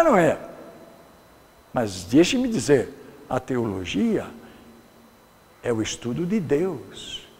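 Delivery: normal talking voice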